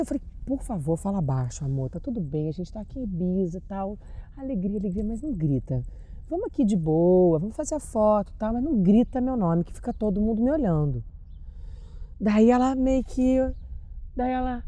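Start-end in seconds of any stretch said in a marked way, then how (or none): 0.4-11.0 much softer, coaxing her to calm down; softly begging a fan not to shout her name; hushed pleading. Retold or imitated. Hushing an excited fan.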